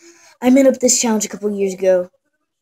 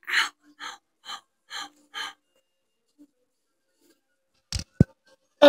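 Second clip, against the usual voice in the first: pained whimpers; 6 semitones higher, 3 dB quieter, faster than usual. Acted. Whimpering from pain.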